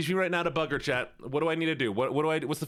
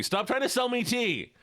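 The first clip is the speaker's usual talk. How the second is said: much higher, slow, mock-indignant yell; mock outraged cry; 12 semitones higher than usual. Streamer accuses the British of selling tea, in mock outrage. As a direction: throw the accusation out high and drawn out.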